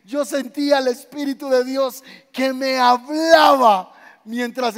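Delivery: loud, slow fervent shout; shouted testimony. Retold or imitated. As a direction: cry it out as a fervent witness. Testimonio gritado de congregante. (testimonio gritado con fervor)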